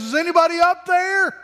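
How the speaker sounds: high, loud call upward; calling up for help. Retold or imitated. Man dangling from a branch calls up, a loud high cry.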